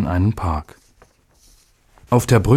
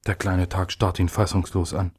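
usual speaking voice

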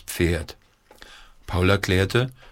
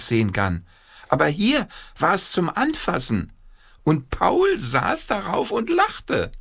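excited narration; 10 semitones higher than usual. A narrator's reading. Narration marvels at zebra.

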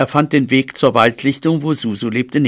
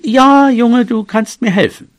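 high, raised voice; loud and high, starting with a burst; loud cat reply; 9 semitones higher, 4 dB louder than usual. A narrator's reading. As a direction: call it out as the sly cat. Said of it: "Und laut sagte sie".

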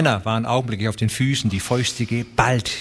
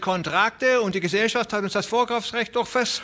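put-on desperate old rancher; 11 semitones higher than usual. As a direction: wail that they were promised.

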